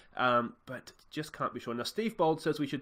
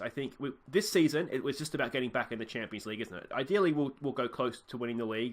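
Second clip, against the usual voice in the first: usual speaking voice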